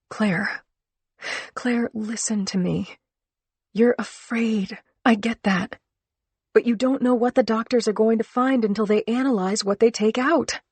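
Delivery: pleading with a scared friend; urgent pleading. Acted. Urging frightened friend.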